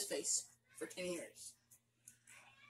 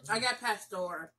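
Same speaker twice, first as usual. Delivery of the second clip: eating while speaking; talking while chewing; 4 dB louder than usual. Real, not acted. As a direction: mouth partly full. Seen 0: Woman eats before speaking; cheeks and lips look like chewing after.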